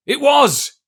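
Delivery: very high, loud burst; excited cry. A narrator's reading. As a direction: leap on the name. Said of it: "cried Silver, now quite excited".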